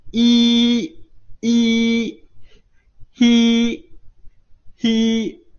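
一 and 嘿 as long, high, level "hee" on outgoing breath; teacher's sustained high model of 一, 嘿 as "hee"; exhaled hi sound. Shown as a demonstration. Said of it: "呼气啊".

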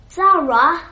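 high, loud shout of a name; loud call. A narrator's reading. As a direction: call her from the door. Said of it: "he called in a loud voice".

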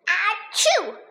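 high, spoken-out "achoo"; acted sneeze. A narrator's reading. Donnie begins to sneeze at the bear, mock sneezing. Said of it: "Donnie begins to sneeze".

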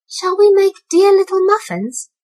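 high little kitten asking eagerly; a small cat pleading sweetly. A narrator's reading. Kitten Mittens asking Moppet to bake, high and eager. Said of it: "said Mittens to Moppet".

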